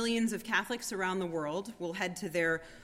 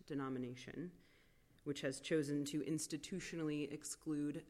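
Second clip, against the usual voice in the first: soft spa whisper; 5 semitones lower, 9 dB quieter than usual. Acted. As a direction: speak gently into the silence. Soft meditative reflection.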